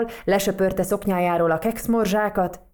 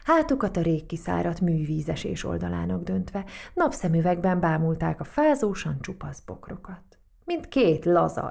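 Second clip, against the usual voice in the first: ordinary delivery, nothing marked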